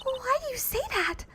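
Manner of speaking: put-on high, light girl voice asking; high girl voice, questioning; high character voice. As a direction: ask it in a high innocent voice. Voicing a character in the game.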